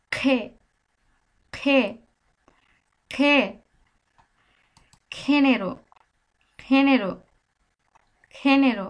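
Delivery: খে and খেনেরো with a nasal ring. Shown as a demonstration. Teacher saying the letter G nasally for clarity. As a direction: push খে through the nose. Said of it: "আমি একটু নাকে বাজিয়ে GK বলতেছি".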